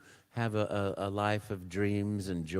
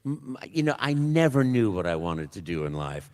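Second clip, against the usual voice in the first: normal talking voice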